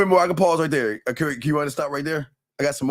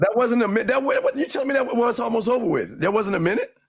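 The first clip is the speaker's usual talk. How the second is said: higher, incredulous protest; can't believe it's that short; surprised disbelief; 10 semitones higher than usual. Real, not acted. To his co-host about the clip length, surprised disbelief. Partner says clip wasn't a minute.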